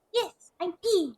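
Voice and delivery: put-on high and slow little girl; ghost girl voice.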